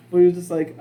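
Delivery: usual speaking voice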